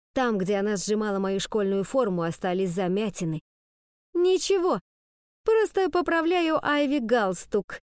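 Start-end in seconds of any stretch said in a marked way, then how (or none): 4.1-7.8 sugary, high fake innocence; syrupy innocent cover story; sweet fake innocence. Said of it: "одарила меня сладкой улыбочкой".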